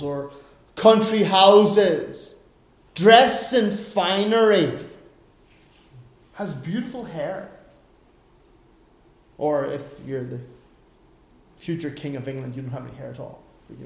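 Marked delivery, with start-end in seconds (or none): none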